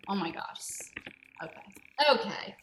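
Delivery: normal talking voice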